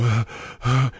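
re-enacted prank breaths; heavy breathing down the phone. Caller prank-breathes at Cliff's daughter by mistake, re-enacted heavy breathing. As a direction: breathe hard into the mouthpiece. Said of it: "and I go".

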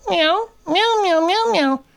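tiny, very high-pitched mews; imitated cat meowing. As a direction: squeak out repeated cat meows. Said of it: "Thunder is all going".

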